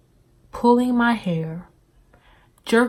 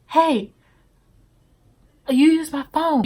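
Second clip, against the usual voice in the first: mock excited call; 7 semitones higher, 5 dB louder than usual. Stranger runs up calling out.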